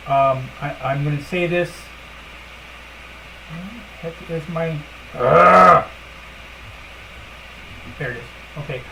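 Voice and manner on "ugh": short loud exasperated ugh; frustrated groan. Real, not acted. Fed up with her computer, a loud groan.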